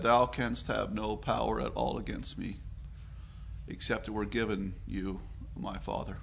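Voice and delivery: quiet, unshaken authority; calm and quiet. Acted. Jesus answering Pilate, calm quiet fading whisper. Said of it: "calmly and quietly said".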